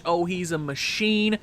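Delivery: usual speaking voice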